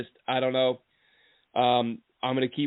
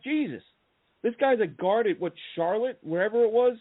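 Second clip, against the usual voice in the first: amazed disbelief; 6 semitones higher than usual. Retold or imitated. Scout watching film, amazed at a small-school guard, high and incredulous. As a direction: pitch jumps high in retold amazement. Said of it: "And I'm like".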